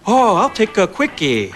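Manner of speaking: put-on slow, accented dad voice; lightly accented Korean dad ordering; dad's Korean accent. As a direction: mimic dad mangling the word. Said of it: "My dad looked at her and went".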